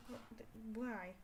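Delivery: ordinary delivery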